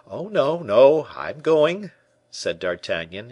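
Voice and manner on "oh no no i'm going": high, loud, drawn-out protest full of curiosity; evident curiosity. A narrator's reading. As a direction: protest slowly, pitch swooping with curiosity. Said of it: "an evident tone of curiosity".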